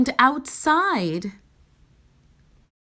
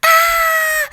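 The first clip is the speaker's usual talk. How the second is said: very high, loud shriek; wordless shriek of rage; loudest yell; 7 dB louder than usual. A narrator's reading. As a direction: let out the biggest scream you can. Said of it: "yelled the loudest yell possible".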